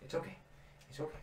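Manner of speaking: soft unvoiced calming; soothing hush. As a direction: quietly settle a spooked animal. Soothing a frightened horse.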